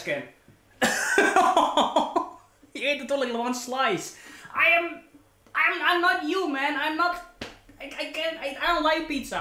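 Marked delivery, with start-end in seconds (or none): 4.5-9.4 excited protest. Real, not acted. Protesting loudly, high.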